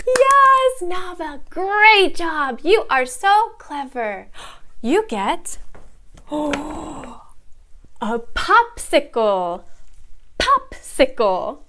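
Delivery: put-on reward a small child with big enthusiasm; gushing teacher praise. Shown as a demonstration. Teacher giving clever student a popsicle, gushing. Demo online phonics class.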